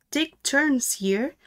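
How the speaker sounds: ordinary delivery, nothing marked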